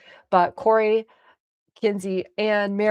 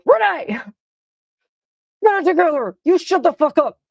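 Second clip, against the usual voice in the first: shout; 9 semitones higher, 6 dB louder than usual. Retold or imitated. Snapping at panicking friend on ride.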